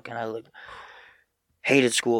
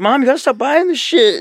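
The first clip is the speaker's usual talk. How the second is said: hammed-up teen yelling, pitched up and louder; mock yell; 14 semitones higher, 11 dB louder than usual. Son mockingly yelling at mom about her clothes buying. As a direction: yell it at mom like a fed-up kid.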